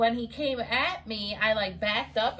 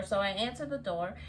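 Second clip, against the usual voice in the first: normal talking voice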